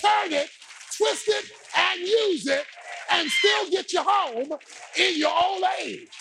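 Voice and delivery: high, shouted sermon climax; full-force preaching. Real, not acted.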